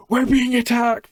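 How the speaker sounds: a villager running in crying for help, hammed up; panicked villager cry. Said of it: "coming down the path and going".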